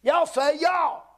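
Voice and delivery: high, punchy pulpit call; rallying call. Real, not acted. Preacher to Southern congregation, rallying them to say it.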